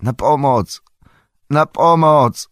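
high, loud cry for help; screaming for help. A narrator's reading. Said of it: "wrzeszcząc".

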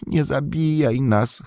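slow, high, whining plea; whimpering Gollum. Acted. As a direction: snivel for mercy like a broken creature. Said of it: "chlipał".

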